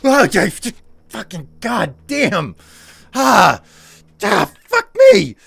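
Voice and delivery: loud, high frustrated outburst; panicked swearing. Real, not acted. Streamer cursing loudly as the game goes wrong.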